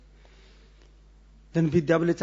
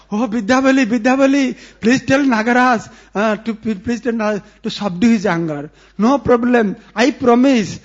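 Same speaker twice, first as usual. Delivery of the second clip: loud, higher, desperate begging; frightened old woman pleading, louder and higher; frantic pleading; 4 semitones higher, 6 dB louder than usual. Acted. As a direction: beg in a panic through the door. Frightened Jatila begging loudly.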